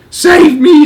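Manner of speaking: very high, loud cry for help; terrified scream. Acted. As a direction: scream for rescue. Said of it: "screamed the flesh lump eater".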